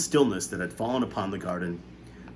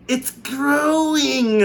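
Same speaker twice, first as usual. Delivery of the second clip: very high, very slow, loud wail; aunt crying out as the peach grows; amazed cry; 8 dB louder, much slower than usual. A narrator's reading. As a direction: draw it out in awe. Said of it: "Aunt Spiker cried".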